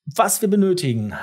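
normal talking voice

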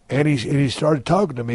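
normal talking voice

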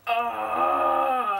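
usual speaking voice

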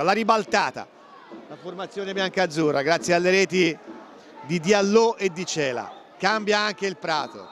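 ordinary talk

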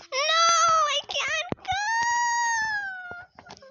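high, crying shout of panic. Acted. Locked-in student crying out to the principal in panic. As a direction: wail it like you're trapped.

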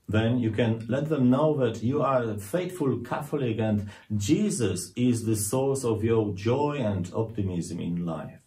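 usual speaking voice

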